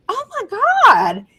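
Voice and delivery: slow, high drawn-out oh my God; shocked gasp. Retold or imitated. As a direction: stretch it out in disbelief. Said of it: "I was like".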